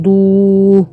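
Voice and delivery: ضو with rounded lips and long oo; rounded damm. Shown as a demonstration. Teacher showing dad joined to waw, long rounded ضو. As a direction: draw out the oo of ضو. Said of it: "لازم نضم كده بقى".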